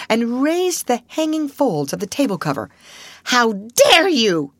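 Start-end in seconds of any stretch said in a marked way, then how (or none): 3.2-4.5 slow, loud, indignant; angry outcry. A narrator's reading. Governess scolding the hidden maid, slow and loud. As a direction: drag out each word in outrage. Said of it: "she cried out".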